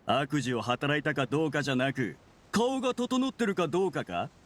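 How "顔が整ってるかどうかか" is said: scornful challenge. Acted. Villain sneers at accusers' standard.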